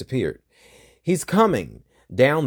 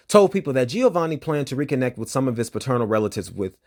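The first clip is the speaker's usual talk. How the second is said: normal talking voice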